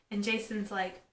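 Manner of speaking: ordinary delivery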